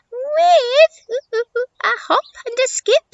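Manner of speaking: very high monkey voice with hoots; excitable monkey. Acted. Monkey answering how she'll cross, high hooting voice. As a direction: squeal it high and loud, hooting.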